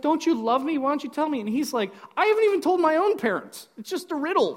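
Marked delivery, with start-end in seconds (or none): 0.0-1.4 high-pitched, pleading wife voice; pleading bride. Retold or imitated. Devastated bride begging Samson to tell her, high pleading voice. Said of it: "She throws herself at him". 2.2-4.6 high, loud mock exasperation; exasperated protest. Samson answering his crying bride, high exasperated protest.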